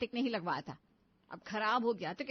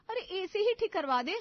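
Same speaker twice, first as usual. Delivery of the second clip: higher, louder frustrated cry; exasperated outburst; 7 semitones higher, 6 dB louder than usual. Acted. Wife exasperated with her husband over the AC, raised voice. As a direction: burst out in frustration.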